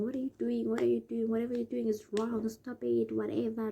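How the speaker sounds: weak, panicky plea; pleading. Retold or imitated. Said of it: "And you keep on saying".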